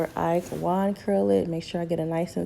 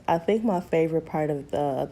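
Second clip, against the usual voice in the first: ordinary delivery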